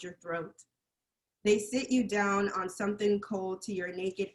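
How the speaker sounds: measured spoken-word reading; poetry recitation. Acted. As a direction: recite it as a poem, line by line. Recites own poem to audience.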